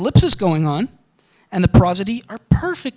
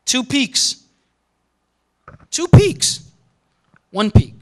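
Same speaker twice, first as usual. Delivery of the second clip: pitch demo; 4 semitones higher, slower than usual. Shown as a demonstration. Lecturer imitating pitch peaks for class, higher. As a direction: sing up the peaks as you name them. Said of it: "pointing at pitch track".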